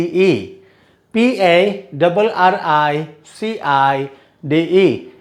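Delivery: letters spelled slowly, one by one; slow spelling out. Shown as a demonstration. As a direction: dictate each letter slowly for the class. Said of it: "मैं सिर्फ spellings पढ़ूंगा".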